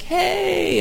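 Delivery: high, drawn-out imitated greeting; mock welcoming cheer. Rally crowd greeting the man they think is theirs, mock cheer. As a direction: imitate a crowd hailing one of their own. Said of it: "they're like".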